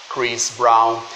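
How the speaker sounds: Chris Brown with the English R and a short i; English pronunciation. Shown as a demonstration. Teacher contrasts English [name] with Portuguese, clearly modelled. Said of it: "esse [name] vai virar [name]".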